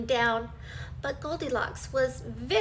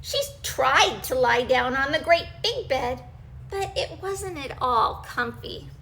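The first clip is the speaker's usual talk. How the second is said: ordinary delivery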